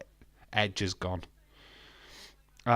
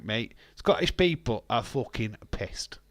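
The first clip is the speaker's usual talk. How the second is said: ordinary talk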